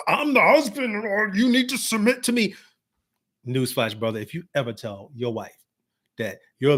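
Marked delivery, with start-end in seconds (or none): 0.1-2.5 high, strained mock-domineering husband; hammed-up overbearing husband voice; screaming bossy husband. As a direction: parody a husband demanding to be obeyed. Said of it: "you don't have to scream".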